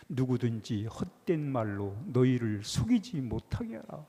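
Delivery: slow, solemn Bible reading; scripture reading. Acted. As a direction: read the verse slowly and weightily. Reads scripture slowly. (성경 구절을 천천히 엄숙하게 낭독)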